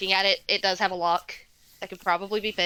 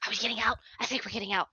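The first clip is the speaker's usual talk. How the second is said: whispering kobolds; 7 dB quieter, much faster than usual. Acted. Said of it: "whispering among themselves".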